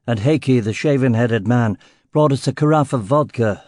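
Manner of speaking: ordinary delivery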